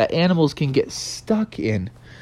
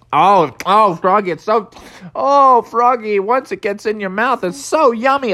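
high, gushing food delight; excited, high-pitched relish; blissful gobbling; 8 semitones higher, 4 dB louder than usual. Acted. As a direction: gush helplessly over a tasty mouthful. Goat relishes eating the vine.

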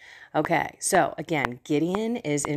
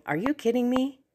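higher, questioning disbelief, leaping up on "Me"; incredulous Gideon; 4 semitones higher than usual. Acted. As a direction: ask it like you can't believe you were picked. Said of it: "he's basically saying".

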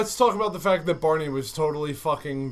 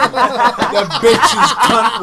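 laughing; 4 semitones higher, 7 dB louder, much slower than usual. Real, not acted. Crude joke amid laughter.

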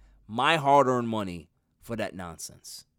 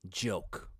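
ordinary delivery

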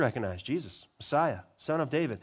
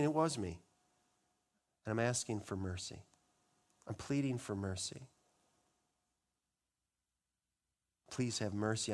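quiet plea; 4 semitones lower, 9 dB quieter than usual. Acted. Voicing a confession.

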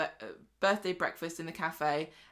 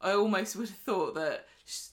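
ordinary talk, nothing marked

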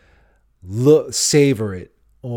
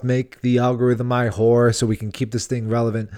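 ordinary delivery

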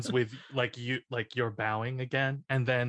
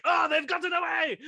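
high mock shout of alarm; guards shouting. Friend acting out the guards noticing the escape, high mock shout. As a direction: yell it like a panicked guard. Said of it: "They're like".

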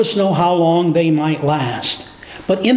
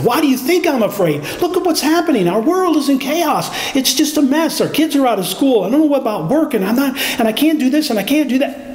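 high, anxious rush of worry; fretful, frantic protest; panicked complaint; 6 semitones higher, faster than usual. Retold or imitated. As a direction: voice the frightened listener, rising to a fret. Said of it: "you might come back with".

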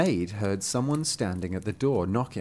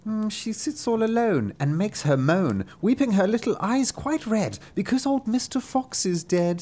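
higher, sing-song cat maid; mild mock mourning, higher; 10 semitones higher than usual. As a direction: mew out the sad news like a gossiping cat. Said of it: "The maid replied".

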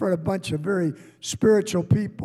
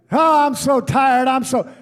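mock tired whine; 5 semitones higher, 4 dB louder than usual. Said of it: "I don't get up and say".

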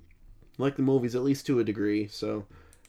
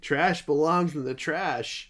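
mock Bill Murray yell; 4 semitones higher, 4 dB louder, slower than usual. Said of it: "and starts yelling".

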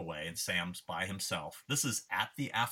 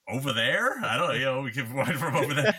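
laughing, voice pitched up; amused, half-laughing reply; laughing while asking; 6 semitones higher, 6 dB louder than usual. Retold or imitated. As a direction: giggle through the question. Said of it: "So I start laughing".

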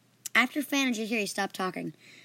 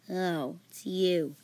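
sour; 3 semitones lower, much slower than usual. Acted. Leprechaun notices an unwelcome visitor, sour. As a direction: say it with sour disappointment. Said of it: "His expression turned sour".

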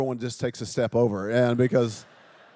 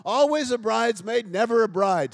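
bitter lament; 6 semitones higher, 8 dB louder than usual. Retold or imitated. Imitating bitter friend's lament.

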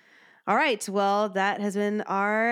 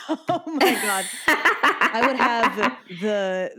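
laughing; 2 semitones higher, 4 dB louder, slower than usual. Real, not acted. Joking about singing at gym.

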